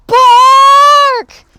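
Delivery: huge, high, loud held shout; booming dog alarm; big bark. Acted. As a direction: blast the word like a watchdog. Watchdog's big bark demonstrated.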